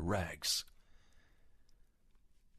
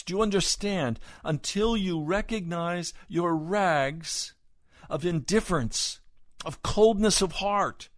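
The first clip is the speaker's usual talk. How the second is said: higher-pitched, forceful sermon delivery building in volume; impassioned preaching; 9 semitones higher, 7 dB louder than usual. Real, not acted. Preacher urges congregation to see their rags, impassioned preaching. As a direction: press each phrase home like a revival preacher.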